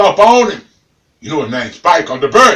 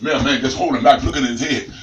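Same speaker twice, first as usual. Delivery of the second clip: usual speaking voice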